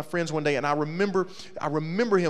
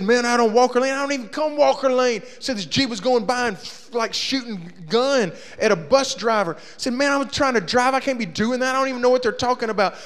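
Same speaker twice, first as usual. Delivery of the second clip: high, loud protest of innocence; indignant rant; 8 semitones higher, 5 dB louder than usual. Retold or imitated. Daniel ranting to the narrator, loud and high. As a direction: protest loudly, you did nothing.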